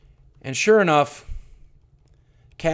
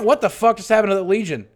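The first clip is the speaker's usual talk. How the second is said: ordinary delivery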